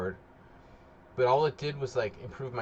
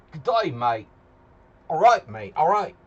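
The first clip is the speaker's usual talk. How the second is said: louder, playful Cockney "mate"; mock Cockney greeting; 4 semitones higher, 11 dB louder than usual. Streamer greets viewers in a loud mock Cockney accent. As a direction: boom out a cheeky Cockney hello.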